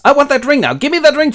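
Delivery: high, loud mock rage; Boromir. Boromir demands Frodo's ring, high angry shouting. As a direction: demand it in a hammed-up outburst. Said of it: "ticks out and goes".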